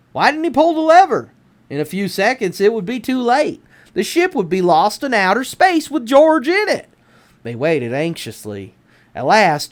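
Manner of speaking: ordinary delivery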